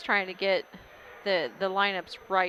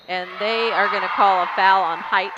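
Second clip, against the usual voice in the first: excited call; 8 dB louder than usual. Real, not acted. Live call of a foul.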